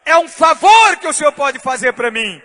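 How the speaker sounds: loud pleading cry to God; preaching shout. Real, not acted. Pastor pleading aloud with God, loud preaching cry. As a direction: cry out the plea at full force.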